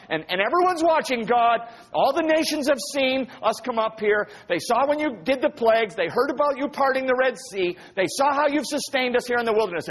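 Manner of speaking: high, insistent acted plea; pleading. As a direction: argue urgently as if begging for mercy. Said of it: "Moses starts pleading with God".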